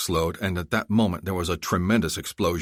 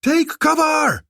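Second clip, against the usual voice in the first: panicked warning; 7 dB louder, much slower than usual. Acted.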